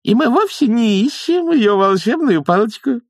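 very high nanny voice; blurting out. A narrator's reading. Nanny lets slip what they are after, blurting. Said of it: "брякнула нянюшка".

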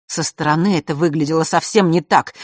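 ordinary delivery, nothing marked

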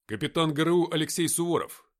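loud, high, crisp military report; чеканный рапорт. A narrator's reading. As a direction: rap out rank and name. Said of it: "отчеканил я". (отчеканить громко, по-военному)

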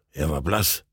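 ordinary delivery

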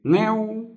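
high mewing cat sound; cat meow. Acted. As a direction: mew like a kitten. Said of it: "ngửa mặt nhìn ông và kêu".

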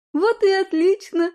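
high, bright, glad exclamation; delighted it worked out; overjoyed. Acted. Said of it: "обрадовалась Людмила".

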